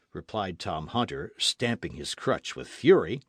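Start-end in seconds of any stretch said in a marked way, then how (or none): none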